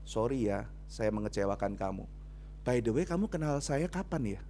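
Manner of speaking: low, quiet polite apology; a hushed ironic sorry; mild mock apology. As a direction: apologise softly, a little ironic. Preacher quotes own gentle apology.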